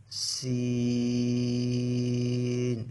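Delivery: সিন stretched long with a strong hiss. Shown as a demonstration. Teacher leading students to repeat সিন, drawn out. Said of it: "আপনারা আমার সাথে সাথে বলুন".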